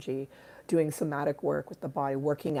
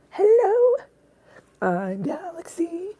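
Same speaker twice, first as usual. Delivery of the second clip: much higher squeaky hello, then slow whispered name; cat voice; 7 dB louder, slower than usual. Pretend. Owner speaking as her cat Galaxy, high squeak then hush. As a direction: play the tiny cat greeting everyone. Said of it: "She wanted to say hi".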